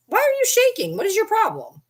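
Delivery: high pet-talk questions. Real, not acted. Asking the shaking dog what's wrong, high pet voice. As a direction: coo at the trembling puppy.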